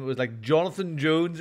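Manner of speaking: ordinary delivery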